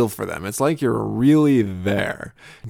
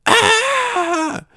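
put-on sudden high child yell into the pew; muffled kid yell; 3 dB louder than usual. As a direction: yelp it high with the head buried.

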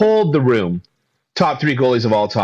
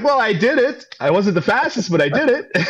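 proud boast; 9 semitones higher than usual. Retold or imitated. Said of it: "I'm like".

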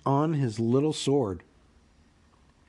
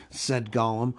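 usual speaking voice

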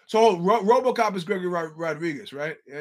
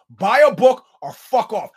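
loud, raised-pitch telling-off; angry dismissal; 4 semitones higher, 10 dB louder than usual. Retold or imitated. Comic telling off a heckler at the merch table, angry. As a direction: send them packing, loud and emphatic.

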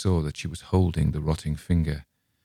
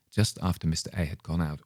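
usual speaking voice

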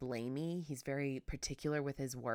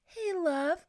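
much higher, sweet greeting; cutesy ask; 13 semitones higher, 5 dB louder than usual. Shown as a demonstration. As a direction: coo it like a small child. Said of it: "everything that comes out of my mouth is like".

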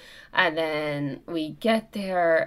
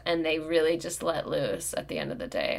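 usual speaking voice

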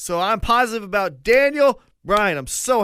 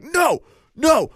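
hammed-up Triple H shouting for Sting; imitating Triple H; 8 semitones higher, slower than usual. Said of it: "Triple H was like".

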